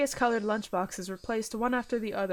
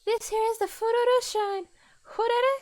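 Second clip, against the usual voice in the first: put-on high girl voice; 13 semitones higher, 3 dB louder than usual. Girl voice, first take.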